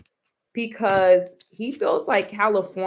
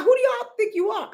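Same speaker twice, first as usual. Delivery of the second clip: fast, high-pitched mock indignation; Donald Trump; faster than usual. Imitating the president challenging California, mad. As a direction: fire it off high, as if insulted. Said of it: "he's mad".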